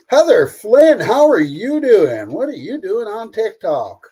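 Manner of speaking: high, loud Southern drawl greeting; Southern Belle greeting. Pretend. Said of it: "Why did I go all southern there?".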